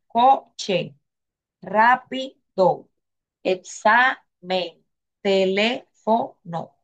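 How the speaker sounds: each word slowly, syllable by syllable; over enunciating. Shown as a demonstration. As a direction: spell out coche, rápido, examen, teléfono slowly. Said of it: "Se lo estoy deletreando así".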